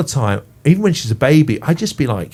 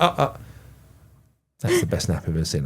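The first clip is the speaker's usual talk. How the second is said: mock stern midwife; 7 semitones lower, 7 dB quieter than usual. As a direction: lower and much softer, a hint of a grudging midwife. Midwife praises his nappy changing.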